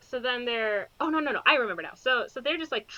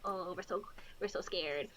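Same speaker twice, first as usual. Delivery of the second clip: mock scared; 4 semitones lower, 14 dB quieter than usual. Mocking the characters' fear.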